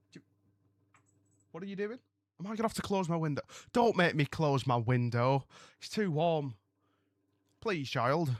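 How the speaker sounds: softly scolding the cat; gently telling off the cat at the window; talking to cat. Real, not acted.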